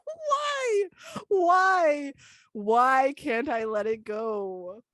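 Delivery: mock anguish. Lamenting own awkwardness to a friend, hammed-up anguish. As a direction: wail it in mock despair, drawing out the end.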